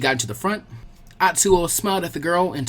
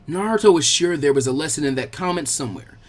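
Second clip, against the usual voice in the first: ordinary delivery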